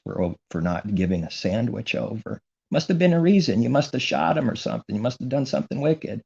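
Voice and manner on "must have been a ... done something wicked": mocking accusers. Preacher mocks people who called the cops, in a high sneer.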